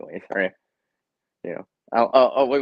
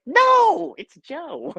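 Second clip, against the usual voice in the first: teasing correction voice; 7 dB louder, much slower than usual. Pretend. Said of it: "I'll be like".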